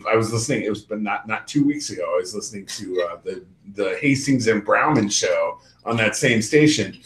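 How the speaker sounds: usual speaking voice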